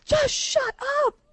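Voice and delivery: high-pitched exasperated yell. Retold or imitated. Fed up with a caller who won't stop, yelling high at the phone. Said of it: "I was yelling at him".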